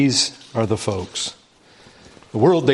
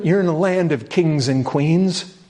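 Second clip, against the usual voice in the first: ordinary talk, nothing marked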